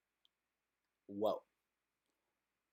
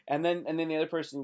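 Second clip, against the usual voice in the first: ordinary talk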